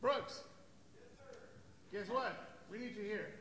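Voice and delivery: loud call across the room. Real, not acted. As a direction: shout for Brooks to come. Calling someone into the room.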